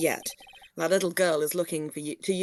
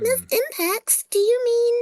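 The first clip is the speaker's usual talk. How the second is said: high, slow, louder character voice; voicing a character; 7 dB louder, slower than usual. Player voicing the game girl, high and slow. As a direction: play the girl, asking in a high voice.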